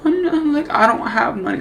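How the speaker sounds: high, whiny voice on the edge of crying; imitating near tears. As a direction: plead like he's about to break down. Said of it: "he's just almost starting to cry".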